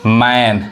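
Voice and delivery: मैन said with a flat ऐ, like "man"; teacher modelling मैन with a flat ऐ sound; model pronunciation. Shown as a demonstration. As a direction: say मैन clearly with an open ऐ vowel. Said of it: "ऐ पढ़ा रहा है".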